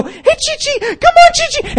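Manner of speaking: imitating very high, squeaky calls to a little dog; excited dog trainer. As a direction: coax a tiny dog in falsetto. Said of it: "they showed it. They go".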